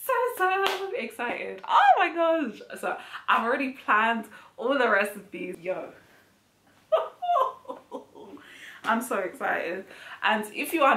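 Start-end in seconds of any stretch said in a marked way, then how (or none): 0.1-2.6 much higher, slow, gushing so excited. Real, not acted. Scene: telling viewers the Caribbean series is coming, gushing real excitement. 6.9-8.8 very high breathless squeal of a laugh; an airy squeal of delight; giddy laugh. Real, not acted. Scene: laughing with delight at her new cookbook plans, high breathless squeal.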